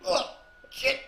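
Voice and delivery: strained whisper after choking; choking, barely voiced; swallowed wrong way. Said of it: "went down the wrong hole".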